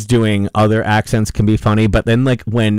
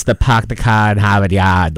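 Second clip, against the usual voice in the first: mock Hollywood Boston accent, dropped R's. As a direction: lightly put on a cliché Boston accent. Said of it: "tries to do a Boston accent".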